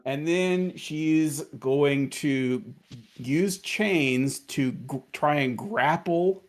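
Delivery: normal talking voice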